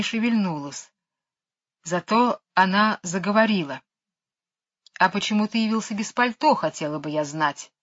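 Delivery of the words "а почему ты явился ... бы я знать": plain reading voice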